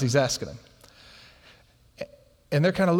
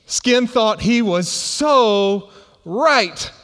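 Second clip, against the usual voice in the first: SO held long and loud, RIGHT set apart; loud, drawn-out "so", separate "right"; emphatic stress; 4 semitones higher, 5 dB louder, much slower than usual. Real, not acted. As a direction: stretch "so" loudly, pause, punch "right". Repeats the punch line for emphasis.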